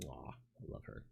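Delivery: tender whisper. Real, not acted. Touched by wife's message.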